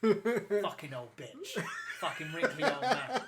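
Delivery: shouting insults through laughter; laughing while yelling. Real, not acted.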